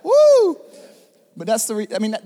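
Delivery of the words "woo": high loud whoop; excited whoop. Real, not acted. Preacher to congregation after the joke, high whoop. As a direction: let out a delighted cry.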